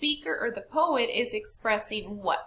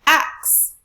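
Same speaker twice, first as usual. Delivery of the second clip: AXE pitched up and loud; stress on AXE; 4 semitones higher, 10 dB louder than usual. Shown as a demonstration. Teacher testing option D with class, stressed. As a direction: hit "axe" high and hard. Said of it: "we have to raise our pitch".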